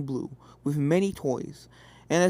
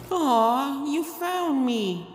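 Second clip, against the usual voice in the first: disappointed ghost colt; 7 semitones higher, much slower than usual. A narrator's reading. Sapphire caught under the bed, disappointed and high. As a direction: draw it out, let down.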